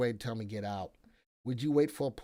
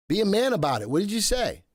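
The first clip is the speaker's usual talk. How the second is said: loud, higher playful taunt; mock challenge; 9 semitones higher, 8 dB louder, faster than usual. Host teasing chat viewer Joey, loud mock taunt. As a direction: call the viewer out, hammed up.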